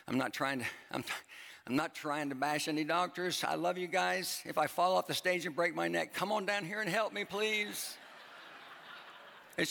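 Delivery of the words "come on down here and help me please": mock plea for help. Joking plea after imagined stage fall.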